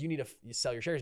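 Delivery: ordinary delivery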